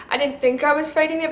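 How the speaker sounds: usual speaking voice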